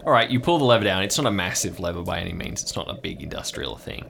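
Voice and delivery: ordinary talk, nothing marked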